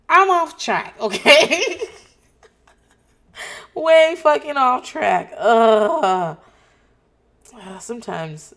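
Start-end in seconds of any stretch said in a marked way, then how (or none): 0.1-6.4 higher, louder laughing admission; giggly, high and loud self-mockery. Real, not acted. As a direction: laugh it off at yourself. Laughing at own rambling.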